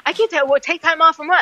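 retelling a panicked refusal to the paramedics; freaking out. Retold or imitated. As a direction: push back in disbelief, pitch up. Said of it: "I freaked out".

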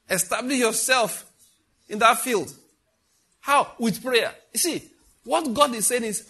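high, loud, slow insistent preaching; full-force preaching. Real, not acted. Pastor voicing God to the church in a loud, rising, insistent cry. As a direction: hammer each repeat harder.

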